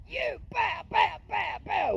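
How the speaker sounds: extremely high shrieking bow bow noise; mom's shrieking. Retold or imitated. Furious mom yelling at her son, extremely high shriek. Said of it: "his mom was pissed".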